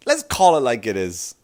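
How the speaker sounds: ordinary talk, nothing marked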